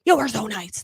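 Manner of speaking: high, loud, gushing thanks; over-the-top energy. Shown as a demonstration. Coach mocking herself thanking grocery store staff, over-the-top energy. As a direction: gush like a manic video host. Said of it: "I don't walk around like this".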